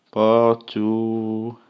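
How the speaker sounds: "portuguese" stretched very slowly, cut short; teacher models the feminine form; slow French word. Shown as a demonstration. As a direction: draw out "portuguese" syllable by syllable. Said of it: "Se for uma portuguesa".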